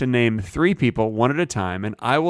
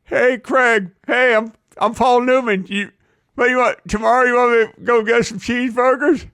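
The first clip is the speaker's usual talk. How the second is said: high goofy voice showing teeth; bad Paul Newman, pitched way up; Paul Newman impression; 9 semitones higher, 3 dB louder than usual. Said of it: "and he sounds like this".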